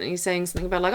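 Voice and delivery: usual speaking voice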